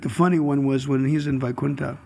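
ordinary talk

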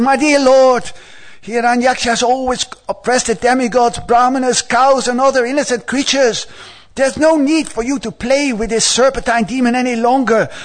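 a little anxious. Acted. Brahma begging the Lord to kill the demon, anxious high plea. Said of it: "he gets a little anxious".

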